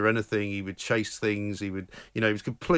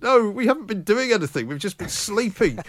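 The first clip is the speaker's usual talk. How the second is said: protesting; 9 semitones higher, 4 dB louder than usual. Retold or imitated. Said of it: "And I'm like".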